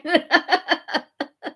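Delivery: short high giggle. Real, not acted. Laughter continues.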